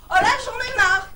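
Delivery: loud, high fed-up cry; exasperated outburst. Acted. Woman fed up with her phone, exasperated outburst.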